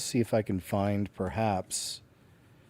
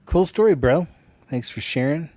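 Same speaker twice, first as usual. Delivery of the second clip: loud, high mock praise; sarcastic shout of cool story bro; 7 semitones higher, 11 dB louder than usual. Real, not acted. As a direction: brush off the story with a sneer. Mocking the story.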